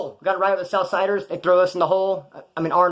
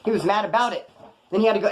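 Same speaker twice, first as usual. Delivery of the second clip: ordinary delivery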